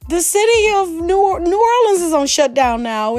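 very high, drawn-out mock panic; mock panicked person. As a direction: ham up a frantic news reaction. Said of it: "You're like".

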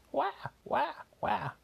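very high squawky wah wah; high parrot mimicking a baby cry; parrot copying a cry. A narrator's reading. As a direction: screech it back like a parrot. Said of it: "It said".

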